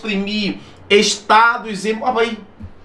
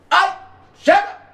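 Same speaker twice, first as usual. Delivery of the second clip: high sharp mock outbursts; acted exclamations; 9 semitones higher, much faster than usual. Shown as a demonstration. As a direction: throw out each cry like it hurt. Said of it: "Interjeição". (gritos encenados de exemplo)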